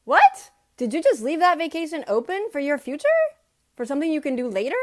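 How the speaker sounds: loud, high astonished what; amazed. Real, not acted. Vlogger marveling at the reframed vacation, amazed. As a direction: burst out in delighted surprise.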